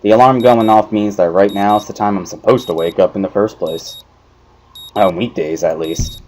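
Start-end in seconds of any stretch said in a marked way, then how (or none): none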